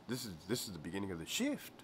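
soft, fast grumble; quiet. Retold or imitated. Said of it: "So I'm like".

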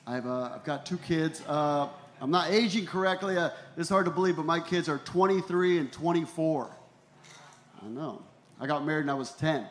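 usual speaking voice

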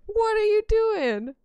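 high, loud, slow exasperated call; high, loud, slow disbelief; incredulous. Real, not acted. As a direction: plead with the dumb character. Yelling at game character.

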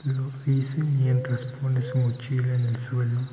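plain reading voice

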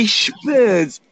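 emphatic exclamation. Real, not acted. Host repeats himself to the stream, calling out. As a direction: call it out with punch.

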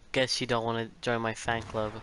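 ordinary talk, nothing marked

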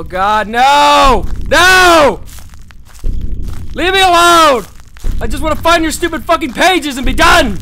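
panicked screams, high and loud; terrified screaming. Real, not acted. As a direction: scream in real terror at the monster. Chased by monster in horror game.

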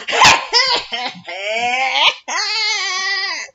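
high cackle stretched into a long wail; exaggerated, drawn-out high mock laugh; mocking imitation laugh. Showing how the foster mom's side laughs, mocking cackle. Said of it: "that's how they're laughing".

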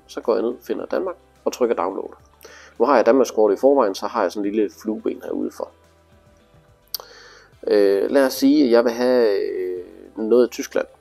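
ordinary talk

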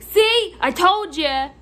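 loud, high gloating shout; retold yelling customer. Customer proving clerk wrong, mock triumphant yell. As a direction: crow it loudly in mockery. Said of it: "you just yelled at me".

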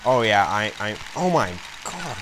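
usual speaking voice

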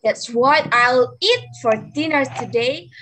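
ordinary talk, nothing marked

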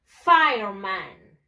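"Fireman" drawn out slowly as FIRE-man; model English pronunciation. Shown as a demonstration. Teacher modelling for pupils to repeat, "Fireman" as slow English FIRE-man. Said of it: "practice and practice".

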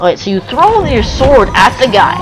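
ordinary delivery

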